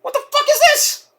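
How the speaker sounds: very high outraged squeak; incredulous. Retold or imitated. Fan reacting to the commercial, high and incredulous. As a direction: disbelief at the ads. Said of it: "I'm like".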